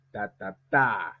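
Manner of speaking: "ta ta ta" chanted slowly with the last "ta" long and falling; final-beat stress. Shown as a demonstration. Teacher drilling word rhythm, last "ta" stressed. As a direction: tap out "ta ta TA", holding the end. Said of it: "On dit".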